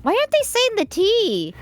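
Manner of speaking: puzzled listener. Retold or imitated. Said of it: "They'll be like".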